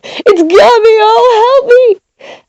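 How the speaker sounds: high, drawn-out wail for rescue; terrified screams. A narrator's reading. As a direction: scream as if seized by a ghost. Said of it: "when they heard my screams".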